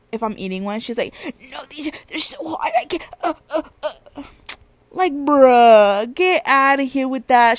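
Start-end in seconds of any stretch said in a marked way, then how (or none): 1.5-4.2 high, soft, panicky whimpering; mock whining sister. Sister offered a hot chip, mock whimpering. 4.9-7.6 loud, scornful outburst; exasperated dismissal. Real, not acted. About sister who can't handle heat, exasperated scoff.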